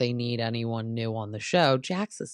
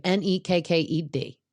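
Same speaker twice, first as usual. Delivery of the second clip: imitated Southern accent, spelling it out higher; Brittany's thick Southern accent; 4 semitones higher than usual. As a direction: spell it letter by letter in mock Southern twang. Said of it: "Her accent is thick".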